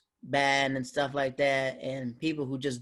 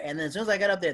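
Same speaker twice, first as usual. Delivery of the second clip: usual speaking voice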